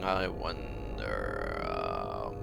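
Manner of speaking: very low, slow villain voice; deep mock-villain voice. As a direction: drop the voice deep and drawn out.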